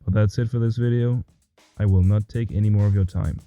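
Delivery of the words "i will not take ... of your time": ordinary talk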